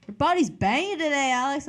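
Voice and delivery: high, loud and hyped; hyped compliment. Acted. Coworker greets Alex arriving at work, hyped and loud. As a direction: call it out like a big greeting.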